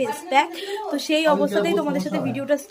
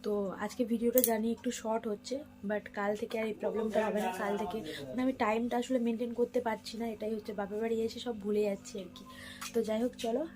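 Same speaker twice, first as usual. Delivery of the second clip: speaking softly; 11 dB quieter than usual. Real, not acted. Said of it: "আর আমি একটু আস্তে আস্তেই কথা বলছি".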